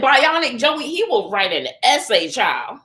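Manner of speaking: usual speaking voice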